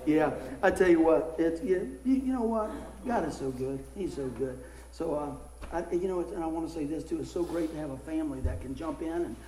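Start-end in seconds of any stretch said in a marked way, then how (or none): none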